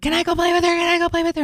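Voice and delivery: put-on high, eager pleading of a little kid; excited child begging. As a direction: beg mom over and over, bouncing with excitement.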